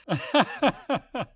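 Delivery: wheezing, hysterical laugh; laughing fit. Acted. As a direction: break into helpless laughter. Roger laughing uncontrollably.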